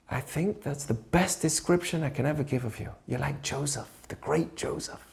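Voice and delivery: soft, hushed and tender; tender father. Retold or imitated. The good father comforting his son, soft and tender. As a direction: speak softly, building the boy up. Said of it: "What did he say?".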